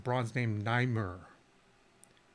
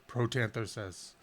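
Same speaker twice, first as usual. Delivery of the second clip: ordinary delivery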